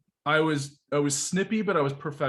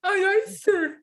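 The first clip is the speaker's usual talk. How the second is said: very high, shrill-pitched scream of a recruit; mock high scream; 3 dB louder, slower than usual. Mocking a recruit's high scream to the drill instructor. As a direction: squeal it like a panicked recruit. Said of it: "he screams like a girl".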